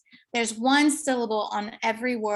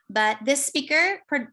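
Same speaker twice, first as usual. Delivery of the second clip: ordinary talk, nothing marked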